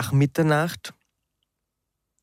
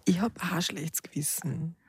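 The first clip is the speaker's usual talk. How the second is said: whispering; 3 semitones higher, 9 dB quieter than usual. Real, not acted. Confessing guilt to a friend.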